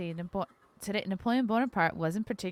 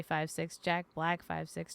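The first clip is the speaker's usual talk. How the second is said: ordinary talk, nothing marked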